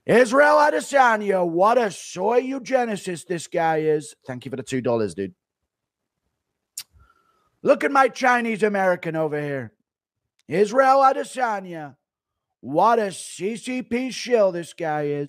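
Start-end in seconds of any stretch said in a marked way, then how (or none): 0.1-4.1 politician impression, high start falling away; Donald Trump. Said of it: "Thoughts on Trump calling Izzy a soy racist?". 7.6-15.3 politician impression, high start falling away; Donald Trump. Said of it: "Thoughts on Trump calling Izzy a soy racist?".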